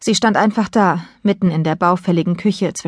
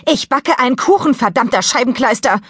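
exasperated outburst, pitched high; cursing mother at boiling point; about to explode; 6 semitones higher than usual. A narrator's reading. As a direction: blow up in high-pitched frustration. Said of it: "Mom sah aus, als würde sie gleich explodieren".

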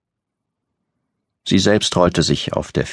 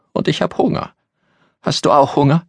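put-on high child voice, pleading; a hungry little girl asking her roommate; childlike and plaintive; small girl voice; 11 semitones higher than usual. A narrator's reading. Child Christina speaks.

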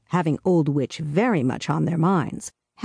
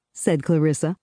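plain reading voice

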